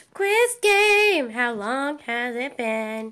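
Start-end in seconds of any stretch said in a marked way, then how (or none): 0.1-1.3 high, loud game-show call; quiz announcer. Pretend. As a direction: announce the quiz with a big punch. Game-show style announcement to friend.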